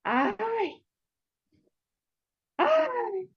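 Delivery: sighing high, close to tears; suspiro lloroso. Acted. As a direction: let out a tearful high sigh. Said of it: "Moishe suspiró y dijo". (ay lloroso y agudo)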